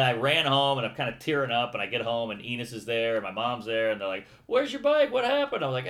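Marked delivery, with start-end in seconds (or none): 4.5-5.6 high, alarmed mother asking; a mother spotting trouble at once; worried mom. Retold or imitated. Mom asking her son where his bike went, high and alarmed.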